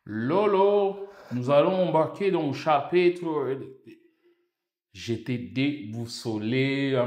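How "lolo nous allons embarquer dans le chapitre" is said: mimicked Québécois professor, higher and louder; Quebec accent. Professor opening a chapter for the class, Quebec accent. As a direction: put on a loud Quebec lecturing voice. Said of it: "l'accent québécois".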